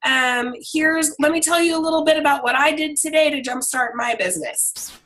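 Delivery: high, bright, calling out hey; upbeat shouted greeting; cheery check-in. Retold or imitated. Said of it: "every single day and say".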